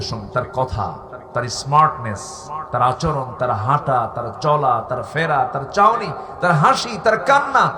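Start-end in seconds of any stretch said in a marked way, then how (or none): 5.5-7.7 building fervour. Real, not acted. Preacher praising the Prophet to the crowd, rising fervent shout. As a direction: list climbing steadily higher and louder.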